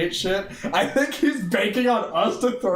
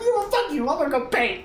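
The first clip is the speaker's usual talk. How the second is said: much higher squeaky mock brush-off; mock telling-off; 10 semitones higher, faster than usual. Streamer acting out a viewer brushing him off, squeaky mock voice. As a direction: toss it off like a cheeky kid. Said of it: "I was like".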